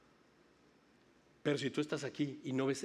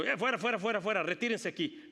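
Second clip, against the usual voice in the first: mock command to leave; 6 semitones higher, 3 dB louder than usual. Jesus clearing out the noisy crowd.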